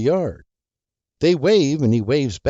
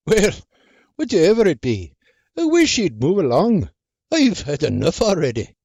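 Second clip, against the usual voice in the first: put-on gruff old man brushing her off; grumpy old farmer; 6 semitones higher, 4 dB louder than usual. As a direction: wave her away grumpily.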